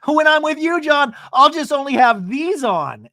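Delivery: high, loud flirty squeal; mock a woman teasing her man. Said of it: "she goes".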